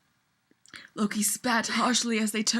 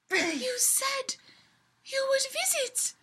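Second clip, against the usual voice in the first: quivering elf; 13 semitones higher, 6 dB quieter, slower than usual. Acted. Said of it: "her voice quivering a bit".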